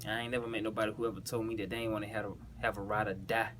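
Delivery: ordinary talk, nothing marked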